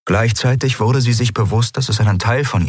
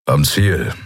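a lightly voiced low grumble; grumbled; 4 semitones lower than usual. A narrator's reading. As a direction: mutter it from the helm. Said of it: "brummte Kero vom Steuer aus".

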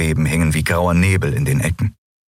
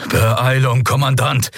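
raised, pressing call; urgent call; 6 semitones higher than usual. Acted. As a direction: hurry him from below. Telios urges from below. (drängender Ruf)

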